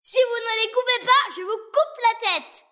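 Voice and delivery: prince yelling. Acted. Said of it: "hurla le fils du roi".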